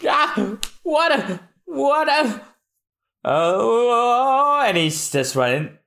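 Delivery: high, straining stammer; high panicky cartoon stammer; high excited Usopp. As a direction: squeak it out mid-fight. Voicing Usopp's battle cry.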